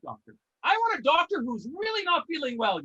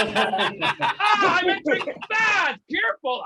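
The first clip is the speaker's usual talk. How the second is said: louder, laughing as he lowers himself in; hammed-up giant sinking into the bath with a laugh; big man easing in; 3 semitones higher, 5 dB louder, much slower than usual. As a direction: play a giant squeezing into a tiny tub. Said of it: "I feel like fucking [name]".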